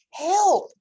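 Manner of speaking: high, loud cry for help. Acted. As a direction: yell it as she's carried off. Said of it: "cried Duck".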